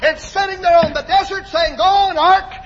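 high, loud rhythmic preacher cry; high-pitched pulpit shout; full-force preaching. Acted. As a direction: drive each phrase out in rising revival fervor.

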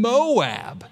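"Moab" hit hard and falling; "Moab" stretched with a heavy fall; stress on MOAB. Shown as a demonstration. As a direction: lean on "Moab" to make the point. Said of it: "so much emphasis on that word".

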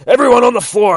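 high, loud barked command; shouted order. Acted. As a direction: yell it at the whole room. Doctor bursts in with shotgun.

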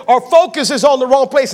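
normal talking voice

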